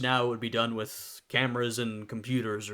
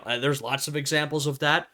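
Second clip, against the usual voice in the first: ordinary talk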